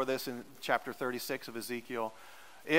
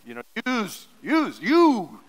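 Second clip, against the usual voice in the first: "use" punched louder and higher each time; emphatic use; 6 semitones higher, 8 dB louder, slower than usual. Shown as a demonstration. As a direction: drive home "use, you" with rising force. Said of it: "To emphasize the fact".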